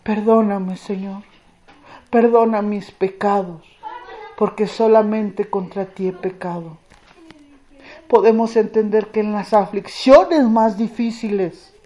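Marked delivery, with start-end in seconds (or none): 0.0-6.8 father's softer broken plea for forgiveness; hushed pleading prayer. Retold or imitated. Preacher voicing father kneeling before God, softer pleading. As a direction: beg forgiveness quietly, sinking low. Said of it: "empieza a clamar".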